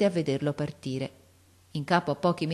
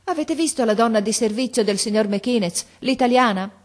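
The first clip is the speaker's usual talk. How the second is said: hasty anxious question; 6 semitones higher, 5 dB louder than usual. Acted. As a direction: rush the question out, louder and higher. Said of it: "domandò precipitosamente, impallidendo". (domanda precipitosa e ansiosa, più alta e forte)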